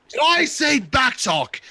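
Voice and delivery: slow, high furious shout; angry shout. Acted. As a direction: snap back at the insolence. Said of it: "he says as he points at you".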